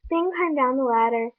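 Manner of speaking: usual speaking voice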